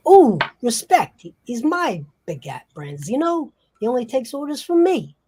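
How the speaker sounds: high, swooping mobster voice; puffed-up wiseguy protest; possessive gangster. Acted. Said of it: "and the other person says".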